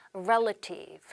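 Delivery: "relative" with first-syllable stress, REL-a-tive; correct pronunciation. Shown as a demonstration. Teacher modelling "relative" with stress on the first syllable. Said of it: "한 번 더".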